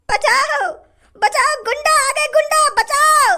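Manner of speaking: high, frightened wail for rescue; panicked cry for help. Acted. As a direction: cry out in panic as if being robbed. Robbery victim calls for help. (घबराकर मदद की ऊँची पुकार)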